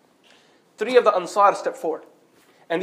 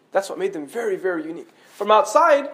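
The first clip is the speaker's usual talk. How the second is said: normal talking voice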